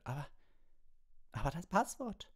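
high, stammered question; shrieked disbelief. A narrator's reading. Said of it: "kreischte Professor McGonagall".

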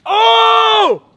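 screaming with hype, way up high; hyped shouting. Real, not acted. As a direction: celebrate at the top of your lungs. Celebrating a big punch.

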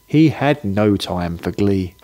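ordinary delivery, nothing marked

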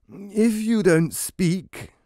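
high, slow stern judge voice. Acted. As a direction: warn them like an impatient judge. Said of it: "the judge told the husband".

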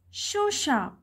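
"Shoe shop" with weight on SHOE, shop falling; stress on SHOE. Shown as a demonstration. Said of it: "عايش أنا شددت".

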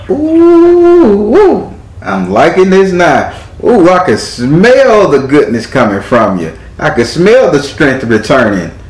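high whooping "ooh", then drawn-out relish; excited, stretched-out praise with big swoops; thrilled. Real, not acted. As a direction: savour it slowly, delighted and impressed. Reader thrilled by client's strength.

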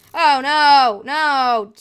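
loud alarmed "oh no, no!"; real panic as the game goes wrong; dismayed shout. Real, not acted. Gamer yelling at a sudden in-game setback, dismayed. A mob attacks them in-game.

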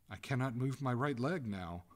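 acted desperate complaint; despairing. A narrator's reading. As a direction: call out in mild despair. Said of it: "cried the ghost in despair".

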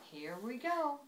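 cheerful group shout. A narrator's reading. Everybody pulling out in the sleigh, mild shout. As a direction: call it out lightly for the whole party. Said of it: "everybody shouted as they pulled out".

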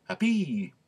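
HAPPY with a high, stretched final "ee"; emphatic E. Shown as a demonstration. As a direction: hit the end of "happy" as "hap-PEE". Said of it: "if it's not an emphatic E".

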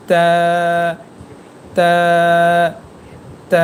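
তা held long, tongue tip on the roots of the upper front teeth; drawn-out, dental তা; letter articulation demo. Shown as a demonstration. Teacher showing the letter ta to learners, long dental তা. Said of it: "এই তা হরফটি উচ্চারণ".

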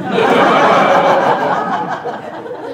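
a loud, unvoiced wicked laugh; breathy evil cackle. Widow cackling after recalling her husband's last words.

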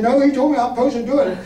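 pushing back firmly; insistent. Retold or imitated.

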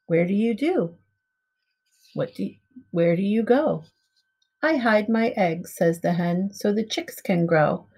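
usual speaking voice

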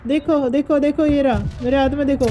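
high-pitched, eager insistence; excited showing off. Real, not acted. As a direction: get a friend to look right now. Shows off the found laddu. (उत्साह में ऊँची आवाज़)

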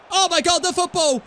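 high, loud, ecstatic shout; goal celebration. Real, not acted. Gamer celebrates his own goal, high ecstatic shouting. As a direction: scream it like you just scored the winner.

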